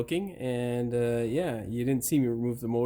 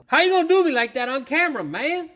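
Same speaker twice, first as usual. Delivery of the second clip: high, loud and fast at the part; real exasperated yell; frustrated shout; 13 semitones higher, 8 dB louder than usual. Real, not acted. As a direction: yell at the stubborn bolt. Yelling at stuck part.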